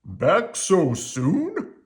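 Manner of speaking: put-on a slow, cheerful dad greeting the kids; Papa's voice. A narrator's reading. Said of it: "asked Papa".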